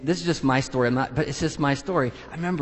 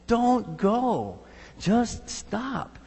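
pleading; 6 semitones higher, much slower than usual. Retold or imitated. People pleading with Paul.

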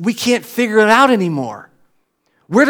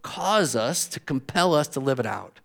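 soft, low closing prayer; 5 semitones lower, 10 dB quieter than usual. Real, not acted. Pastor closing prayer to God, soft and low. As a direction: pray it quietly over the church.

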